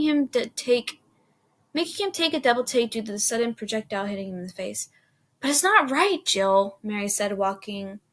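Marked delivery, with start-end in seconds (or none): 5.4-6.8 high, loud protest; complaining. A narrator's reading. Mary objecting to Jill's snowball throwing, complaining. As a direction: object to a friend in a raised voice. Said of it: "Mary complains".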